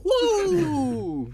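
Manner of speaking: hoarse fading whoop; a strained, cracking whoop; cracked voice. Real, not acted. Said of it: "J'ai la voix cassée".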